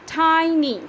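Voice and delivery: TINY in two loud, high beats, ti, ny; syllable by syllable. Shown as a demonstration. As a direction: split "tiny" firmly in two. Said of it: "Tiny are two syllables".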